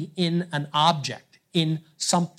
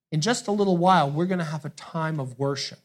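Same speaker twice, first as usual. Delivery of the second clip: ordinary delivery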